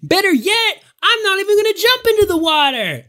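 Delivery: high, strained rooftop yell; boastful challenge. Acted. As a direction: top the dare for the crowd. Rival boasts to crowd from roof.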